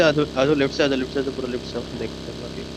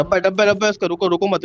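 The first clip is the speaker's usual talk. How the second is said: higher, louder repeated call-out; urgent warning; 6 semitones higher, 4 dB louder than usual. Real, not acted. Driver alerting squad to a loot crate, urgent. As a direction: warn the squad in a rush.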